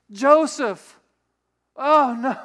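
loud, high cry of dread; dismayed realisation. Acted. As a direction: act out sudden horrified remembering. Mary remembers she must tell Joseph.

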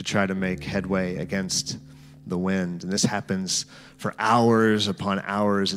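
guided scripture meditation. Acted.

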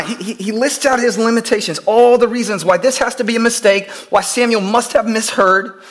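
usual speaking voice

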